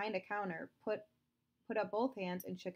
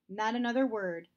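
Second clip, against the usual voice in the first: stern; 3 semitones higher, 8 dB louder than usual. Acted. Father cutting Justin off.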